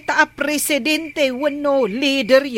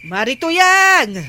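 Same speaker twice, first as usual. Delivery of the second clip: calling out; 6 semitones higher, 4 dB louder, slower than usual. Acted. Calling out to someone outside.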